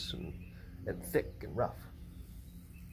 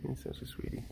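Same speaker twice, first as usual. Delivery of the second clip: whispering; 9 dB quieter, faster than usual. Real, not acted. Whispering to a pet.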